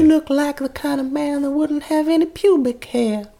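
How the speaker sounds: high-pitched Deep South belle; Deep South accent. Acted. Hypnotist sizes up her date, Deep South belle accent. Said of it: "like, deep south".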